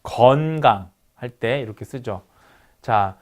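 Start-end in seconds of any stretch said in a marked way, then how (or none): 0.0-0.9 "건강" with a long, drawn-out 어 in 건; 장모음 어. Shown as a demonstration. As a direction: stretch the first syllable of "건강". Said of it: "장모음 어를 쓸 때". ("건강"의 "건"을 길게)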